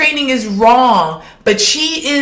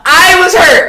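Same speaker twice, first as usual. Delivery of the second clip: higher and louder cry of hurt; hurt outcry; 7 semitones higher, 5 dB louder than usual. Real, not acted. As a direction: call out your hurt, half joking. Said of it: "I was personally hurt".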